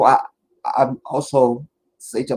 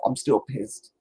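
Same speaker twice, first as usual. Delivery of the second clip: ordinary talk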